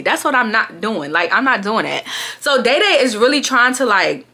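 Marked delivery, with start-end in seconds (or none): none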